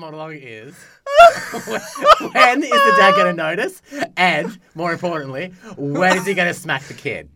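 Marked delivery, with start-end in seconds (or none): 0.7-7.3 laughing. Retold or imitated. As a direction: voice high and loud through laughter. Internal monologue said laughing.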